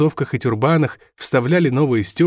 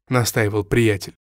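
plain reading voice

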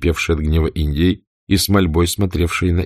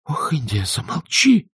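exclaiming; 9 semitones higher than usual. A narrator's reading. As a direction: stop her, alarmed. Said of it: "воскликнула Мелани".

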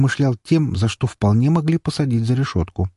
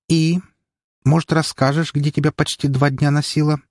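higher-pitched sister voice, pressing for an answer; questioning sister; 6 semitones higher than usual. Acted. Sister asks her brother where he has been, questioning in a higher voice. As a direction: voice a sister demanding an explanation, pitched up.

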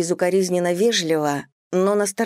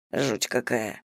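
shuddering; 7 semitones lower, 7 dB quieter, slower than usual. A narrator's reading. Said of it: "содрогнулся Диксон".

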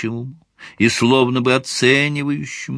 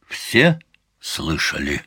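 dark lord; 8 semitones lower, slower than usual. Acted. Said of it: "страшным зловещим голосом протянул комиссар".

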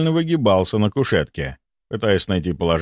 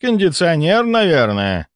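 absent-minded; 7 semitones higher, slower than usual. A narrator's reading. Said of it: "рассеянно ответил Волконский".

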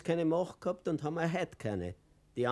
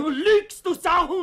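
screaming insult, much higher and louder; furious shout; 12 dB louder than usual. Acted. Fuchs screams at the doctor who lies, furious. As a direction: explode with rage.

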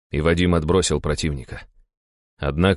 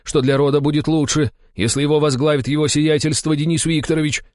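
high, insistent raised voice; barked reproach; 10 semitones higher, 4 dB louder than usual. A narrator's reading. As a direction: throw his own words back at him. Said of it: "рявкнул на него другой дружинник". (резко упрекнуть)

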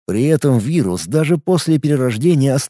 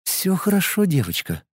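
tender; 5 semitones higher, 7 dB quieter than usual. Acted. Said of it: "с нежностью".

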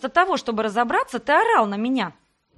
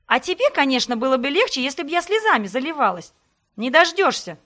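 louder, higher furious retort; shouting back; 3 semitones higher, 4 dB louder than usual. Acted. Heroine shouts back at Sebastian in their fight. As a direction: yell back, refusing to cry.

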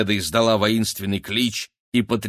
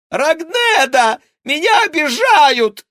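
very high, loud, slow wail; whiny yell; 8 dB louder, slower than usual. A narrator's reading. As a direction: wail for help like a tattletale. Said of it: "завопил крыс".

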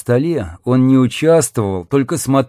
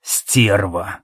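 low-pitched venomous mutter; bitter curse; 4 semitones lower than usual. A narrator's reading. Jackson watching the woman go off, bitter curse. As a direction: curse her darkly under your breath.

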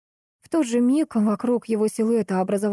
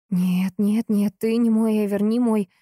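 ordinary delivery, nothing marked

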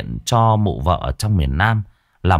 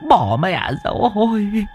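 put-on much higher, pleading old man in panic; panicked old man. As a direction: beg for help, voice jumping way up.

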